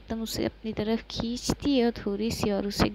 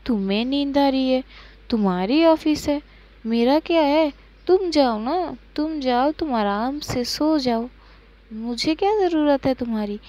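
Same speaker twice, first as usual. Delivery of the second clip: higher and louder sulky retort; mock offended; 3 semitones higher, 6 dB louder than usual. Pankhuri sulks at her sleepy partner, higher and louder. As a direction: throw her words back in a huff.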